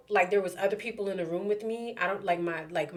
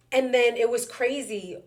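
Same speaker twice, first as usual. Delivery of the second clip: ordinary talk, nothing marked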